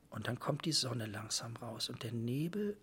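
whispered, slow and hushed, as the fog lifts. Retold or imitated. Conductor telling the interviewer of sunrise at the Marienburg, whispering. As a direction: breathe the words as if the castle looms up.

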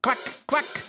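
high, quacking duck call. A narrator's reading. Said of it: "machte Hi-Hat". (hohes Entenquaken)

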